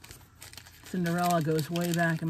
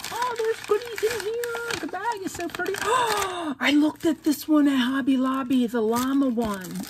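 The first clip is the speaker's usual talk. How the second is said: much higher, with a gasp; excited discovery; 8 semitones higher, 4 dB louder than usual. Real, not acted. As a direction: squeal finding the goodies. Discovering goodies in bag.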